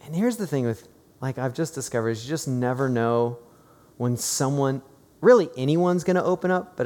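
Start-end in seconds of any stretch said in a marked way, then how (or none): none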